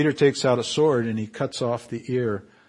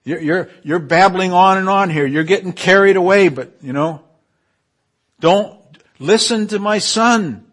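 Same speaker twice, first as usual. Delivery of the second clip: higher and louder, telling Peter off; a hammed-up heavenly rebuke; mock scolding God; 6 semitones higher, 9 dB louder than usual. God telling babbling Peter to shut up, mock scolding. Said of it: "It was almost like he's saying".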